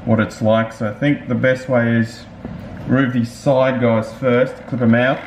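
speaking inside full-face helmet; through helmet chin bar. Real, not acted. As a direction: mouth behind chin guard. Seen 1: Full-face helmet chin bar covers mouth throughout; removed after 20s.

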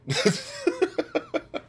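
high, wheezy burst of laughter; soft, high-pitched giggling laugh. Real, not acted. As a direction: crack up at your own joke. Laughs at own crude joke.

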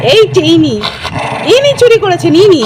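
loud, high cries of thief; mock accusation. Owner exposing the pet who took the pillow cover, loud mock accusation. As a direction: call out the thief in pretend outrage.

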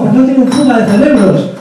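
ordinary delivery

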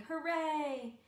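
high, drawn-out storybook cheer; cheering shout. A narrator's reading. Villagers see whale freed, shouting in joy. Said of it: "villagers shouting".

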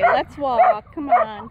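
ordinary delivery

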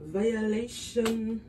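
slow, stretched "Violation" with each syllable drawn long. Shown as a demonstration. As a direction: drag out "Violation" slowly.

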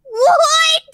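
loud, high-pitched "what?"; shocked disbelief. Retold or imitated. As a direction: cry out in stunned disbelief. Acts out her shocked reaction.

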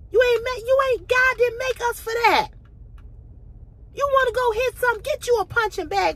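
high, loud and fired up; heated. Real, not acted. Talking to her abusive ex, furious and high-pitched. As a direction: let the anger at him pour out.

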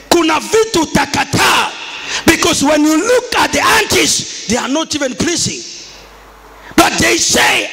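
high, slow, emphatic exhortation; full-force preaching. Acted. Preacher warning the congregation about the aunties' customs, full-force preaching.

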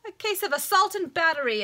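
high, loud lawyer's outburst; exclaiming. A narrator's reading. Lawyer crying out over a struck eye, exclaiming. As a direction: pounce on it as an instant accusation. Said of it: "instantly exclaimed Mister".

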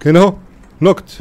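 excited callout. Real, not acted. Announces knock.

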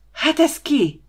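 high, loud burst of outrage; outraged roar. Acted. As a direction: cry out like a wounded beast. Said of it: "hördült fel Csaba, mint egy sebzett vadállat".

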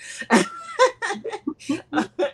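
high, loud burst of laughter; big high-pitched giggling laugh; real laugh. Real, not acted. Laughing at own blindness joke.

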